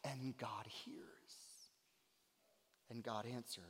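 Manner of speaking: near-whispered, low preaching; soft and weighty; hushed awe. Real, not acted. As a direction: drop suddenly to a reverent whisper. Preacher drops to reverent hush.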